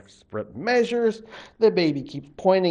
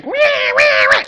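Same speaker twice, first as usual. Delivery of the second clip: put-on high, loud baby wail; shrill newborn crying; 12 dB louder than usual. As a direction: wail like a big spirit baby. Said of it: "And he cries".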